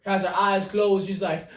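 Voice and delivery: ordinary delivery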